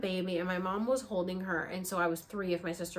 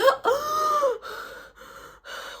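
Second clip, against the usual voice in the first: high, loud gasp for air; waking from sleep, gasping for breath; desperate gasp for air; 6 dB louder than usual. Retold or imitated. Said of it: "get up and be like".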